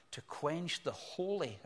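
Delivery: "holy" stressed and drawn out slowly; slow down and lean on "holy". Shown as a demonstration. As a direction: give "holy" deliberate emphasis without raising the volume. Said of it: "I emphasize the word holy".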